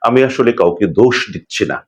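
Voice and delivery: ordinary talk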